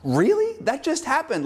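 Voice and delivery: high, incredulous mock; sarcastic disbelief. Viewer scoffing at a TV product placement, high sarcastic disbelief. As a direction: act stunned by the blatant ad. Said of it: "And I went".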